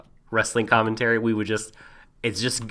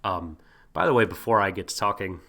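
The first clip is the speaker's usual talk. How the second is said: ordinary talk, nothing marked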